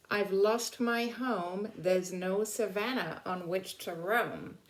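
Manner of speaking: put-on reading poem to children. Teacher reading the lion's poem to the class, grand and lilting. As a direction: give the lion's boast a grand storybook lilt. Rereads lion lines.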